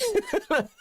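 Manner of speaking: short high laugh; real amused giggle. Real, not acted. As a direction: giggle mid-sentence. Cracking up.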